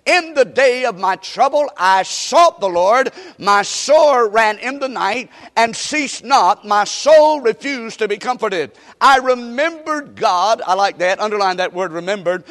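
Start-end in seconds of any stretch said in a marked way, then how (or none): 0.1-10.6 slow, emphatic pulpit reading; scripture reading. Real, not acted. Preacher reads the psalm to the congregation, slow proclamation. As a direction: proclaim the psalm with drawn-out words.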